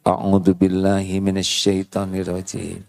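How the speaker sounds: usual speaking voice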